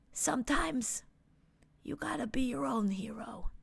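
soft, breathy, earnest little voice; whispered resolve. A narrator's reading. Little friend flying home to Earth, softly whispered resolve. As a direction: breathe it as a quiet vow.

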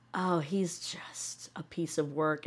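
snide whisper, slow and soft; breathy whispered dig; whispered aside. Real, not acted. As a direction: confide it in a whisper. Snide aside about him.

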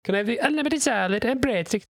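high, hammed-up fake Italian accent; mock Italian-American diner, high. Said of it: "the fake Italian people".

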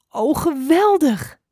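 high, loud, drawn-out cheer; delighted exclamation. A narrator's reading. Doeltje hears adder may paint, delighted cry. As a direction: burst out with slow, soaring delight.

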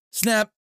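loud, high punchy "Snap"; sharp snap sound. Retold or imitated. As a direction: snap it out like the finger click. Imitating the finger snap.

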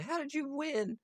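high mock-weepy villain voice; mock crying villain. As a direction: whine it like a sore loser, hammed-up. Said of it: "like, crying".

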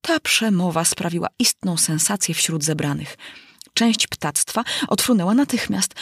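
ordinary delivery, nothing marked

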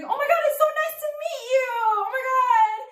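high, fast, excited mom voice; gushing mother. Acted. Mother meeting the high school boyfriend, high and gushing. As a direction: gush a greeting in an exaggerated high pitch. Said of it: "She'd be like".

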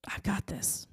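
a whispered memory of God's promise; whispered echo. Retold or imitated. God's words echoing in her head, whispered. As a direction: let it float in, barely voiced.

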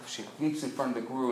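ordinary delivery

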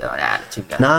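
deep resigned mock sigh; mock grumble. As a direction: drop into a dopey low voice of giving up. Mocks his own resigned reply.

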